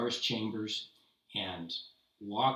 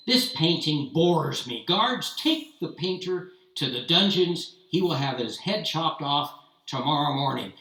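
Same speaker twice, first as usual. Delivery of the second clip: stern royal command; 4 semitones higher, 7 dB louder than usual. Acted. Tsar frowns, orders execution.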